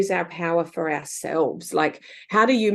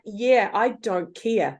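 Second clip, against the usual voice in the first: careless drawn-out shrug; 2 semitones higher, much slower than usual. Retold or imitated. Menopause indifference.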